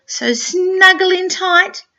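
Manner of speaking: slow, lifted and cozy; soothing storybook read. A narrator's reading. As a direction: draw out the snuggle for the child. Reading to a child.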